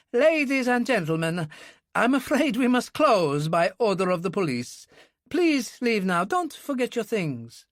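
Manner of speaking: a rattled manager announcing closure. A narrator's reading. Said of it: "raised his voice shakily".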